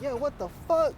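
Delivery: a mock startled cry, high; shocked outburst. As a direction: blurt it out as if seeing the blood leak. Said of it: "I'm like".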